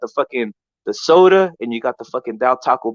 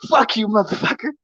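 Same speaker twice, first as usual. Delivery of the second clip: higher mock old lady curse; imitating old lady; 7 semitones higher than usual. Lightly voiced old lady out her window, higher mock curse. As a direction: play the eighty-year-old cursing him out. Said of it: "she's like".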